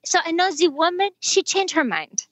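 put-on high French-accented patter, dropping at the end; playful imitated French accent in halting English; broken English, French accent. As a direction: deliver the punchline as a Frenchman. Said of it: "kind of in his broken f-, English".

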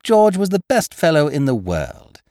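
ordinary delivery, nothing marked

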